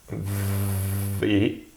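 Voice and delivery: "V" begun with a long buzzing v before "é"; first sound drawn out. Shown as a demonstration. Teacher spelling avons, "V" with prolonged v. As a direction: stretch the consonant at the start of "V".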